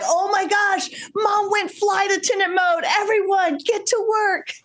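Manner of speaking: put-on high, excited kids in a panic; panicked kids voice. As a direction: squeal it like alarmed children. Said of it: "They're like".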